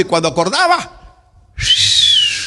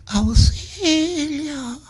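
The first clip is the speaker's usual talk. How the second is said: fading cry; 10 semitones higher, 4 dB quieter, much slower than usual. Acted. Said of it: "Se está muriendo".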